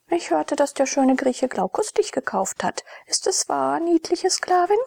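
lifted voice, rising through the questions; inquisitive noble lady. A narrator's reading. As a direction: question the slave girl from above. Narrator voices Julia's questioning.

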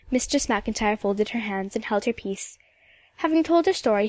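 usual speaking voice

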